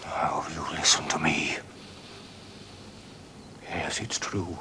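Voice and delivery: hushed, pressing confession; urgent whisper. Acted. Father to son, hushed confession. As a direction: lean in and admit it under your breath.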